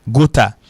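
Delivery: gotha said "go-TA" with a hard t; common mispronunciation. Shown as a demonstration. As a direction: say gotha French-style, crisp t and final a. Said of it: "en pile monde prononce".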